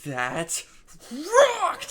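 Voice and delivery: sudden high excited shout on rocked; a burst of joy at the last word; elated cheer. Retold or imitated. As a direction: whoop it out in pure elation. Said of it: "in pure elation".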